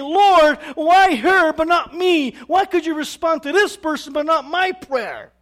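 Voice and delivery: very loud, high-pitched aggrieved plea; complaining to God. Acted. Believer to God about another's answered prayer, complaining loudly. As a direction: protest to heaven at full voice. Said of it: "if we start to complain".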